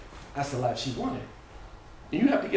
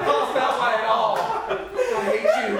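loud, raised denial; angry protest; 6 semitones higher, 4 dB louder than usual. Acted. Daughter denying it to her father, loud and angry. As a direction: shout back in hurt outrage.

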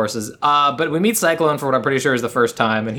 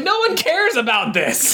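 high, drawn-out exasperation; exasperated outburst; 11 semitones higher, slower than usual. Acted. Host reacting to the film, high exasperated protest. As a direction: cry it out slowly, pitched well up.

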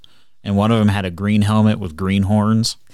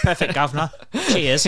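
high, chirpy mock Cockney thanks; cheery London geezer voice; British accent; 7 semitones higher than usual. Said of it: "And he's like".

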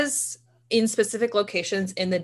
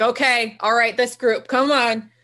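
louder, higher, hustling the group along; bossy guide; 4 semitones higher, 4 dB louder than usual. Pretend. Sherpa on Everest calling up the next group, bossy. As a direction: play a guide herding climbers.

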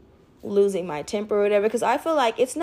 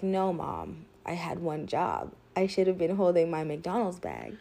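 put-on much softer, lower, subdued child voice; mother imitating her daughter's quiet remorse; sad little girl; 5 semitones lower, 7 dB quieter than usual. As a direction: say it dejected, eyes down.

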